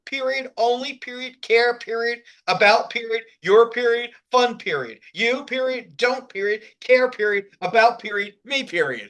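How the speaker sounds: each word separated by a spoken period; every word stopped. Retold or imitated. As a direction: say period after every single word, staccato. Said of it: "a punctuation stroke".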